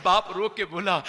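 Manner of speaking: usual speaking voice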